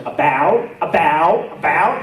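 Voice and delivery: rising, puzzled mock repeats of about; mocking friends. Home friends teasing his changed about, rising puzzled mimicry. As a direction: tease the word like it sounds weird. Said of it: "my friends are like".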